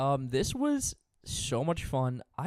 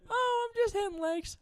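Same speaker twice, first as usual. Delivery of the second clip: much higher mock girl's voice, breezy; imitating her; 5 dB louder than usual. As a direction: lift way up into her airy, casual answer. Retelling the girl's casual reply.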